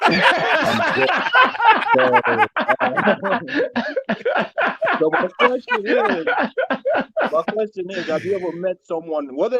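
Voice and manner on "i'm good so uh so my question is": high, loud words breaking into laughter; real laughing. Real, not acted. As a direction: crack up while trying to get the question out. Caller cracks up telling his story.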